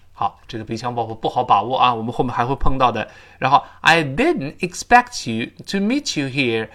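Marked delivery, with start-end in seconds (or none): 3.8-6.7 slow, careful model sentence; slow model reading. Shown as a demonstration. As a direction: read it slowly for students to follow. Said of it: "Expect就是想到嘛". (慢速示范朗读)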